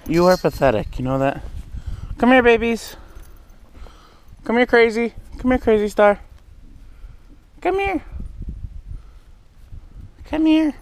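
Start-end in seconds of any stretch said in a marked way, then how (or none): 2.2-10.7 high-pitched calling to pets; coaxing animals. Real, not acted. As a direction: coax the animals over in a high sing-out. Coaxing skittish animals to come.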